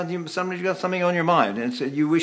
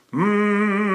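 pressed-lip "mm", pitched higher; lip clamp; 4 semitones higher than usual. Shown as a demonstration. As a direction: press the lips into a held "mm". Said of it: "How about lip clamp?".

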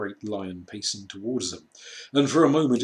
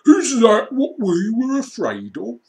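much higher, louder squeaky mockery; jeering creature; 12 semitones higher, 11 dB louder than usual. A narrator's reading. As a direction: shriek it scornfully. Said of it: "said another".

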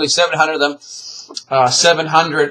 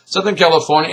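ordinary talk

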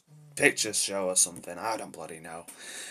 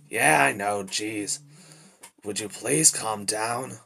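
ordinary talk

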